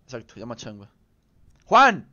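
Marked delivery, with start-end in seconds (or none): 1.6-2.1 loud call across the room; calling a friend's name loudly. Real, not acted. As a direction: shout his name to get his attention.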